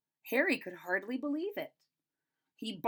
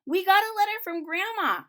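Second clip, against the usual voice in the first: put-on high, loud child's call; bursting with the news; excited child; 10 semitones higher, 8 dB louder than usual. A narrator's reading. Child telling Harry about grandma, high and loud. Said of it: "one of 'em said".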